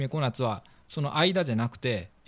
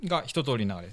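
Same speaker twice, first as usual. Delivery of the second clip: ordinary talk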